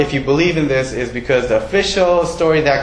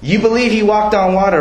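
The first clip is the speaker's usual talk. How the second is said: excited; 8 semitones higher, 4 dB louder, faster than usual. Real, not acted. Rousing the congregation.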